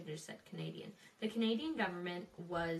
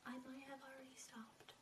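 whispering; 3 semitones higher, 17 dB quieter than usual. Real, not acted. Conspiracy aside, sudden whisper.

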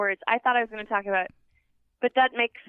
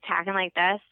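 normal talking voice

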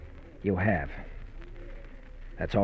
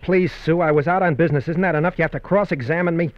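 louder, higher, defensive snap; defensive outburst; 7 semitones higher, 3 dB louder than usual. Acted. Dave snapping at his wife Sue: defensive anger. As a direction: push back at being questioned.

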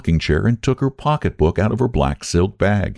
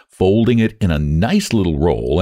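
ordinary delivery

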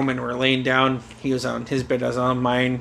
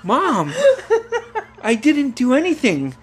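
higher-pitched reenacted son's protest; pleading innocence; 12 semitones higher than usual. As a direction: plead with mom in a lifted voice. Son protests innocence to mother.

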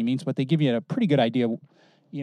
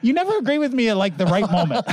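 high, laughing tease; 7 semitones higher, faster than usual. Real, not acted. Teasing co-host while laughing.

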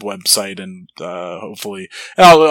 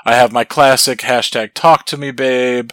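ordinary talk

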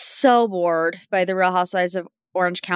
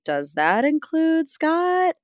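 slow, high incredulous question; stunned disbelief; shocked question; 8 semitones higher, slower than usual. Retold or imitated. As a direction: ask it aghast, drawn out. Imagined question to herself.

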